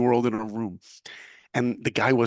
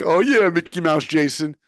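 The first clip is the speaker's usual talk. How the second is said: gleeful mock jab; 6 semitones higher, 7 dB louder than usual. Mocking thought about roommate.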